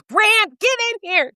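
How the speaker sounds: imitating grandma yelling for grandpa; retold yell. Grandma yelling for Grant after hearing the news, mock yell. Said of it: "she yells to my grandpa".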